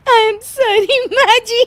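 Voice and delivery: high giggly apology bursting into a long laugh. Acted. Daughter-in-law apologising to mother-in-law, laughing. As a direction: try to apologise and crack up. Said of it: "फिर से हंस रही है".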